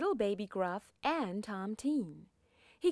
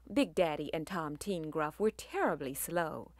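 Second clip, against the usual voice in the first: plain reading voice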